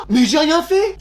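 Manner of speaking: high-pitched indignant objection; wronged-pupil protest; protesting innocence. Acted.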